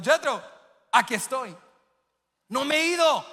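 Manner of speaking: high, loud reassuring call; father calling out. Retold or imitated. Father calling loudly to his frightened son that he is there. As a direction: call across the garage so the boy hears you.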